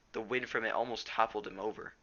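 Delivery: ordinary delivery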